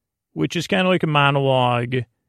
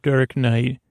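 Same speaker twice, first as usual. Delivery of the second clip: ordinary delivery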